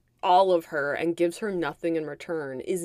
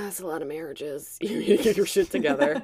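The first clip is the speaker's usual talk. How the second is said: laughing mom quote. Retold or imitated. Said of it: "her mom's like".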